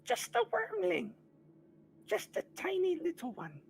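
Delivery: very high, loud squeaky creature voice; a sad little kobold fondly remembering his pet; Meepo describing the dragon. Acted. Meepo describing his lost wormling Calcrix, high mournful voice. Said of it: "and he describes it".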